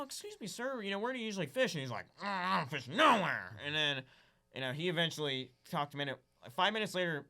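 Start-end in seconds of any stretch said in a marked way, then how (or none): 0.0-1.7 polite dad. Retold or imitated. Dad politely approaching old man. 2.2-3.5 put-on gruff old man. Grumpy old man replying.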